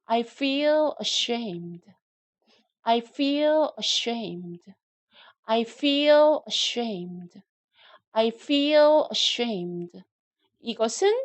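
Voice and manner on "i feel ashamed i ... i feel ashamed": very slow, clear model sentence; slow drill reading. Shown as a demonstration. Teacher reading slowly for students to repeat. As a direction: read it slowly for learners to repeat. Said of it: "예, 자기가 그렇게 느끼는 거예요".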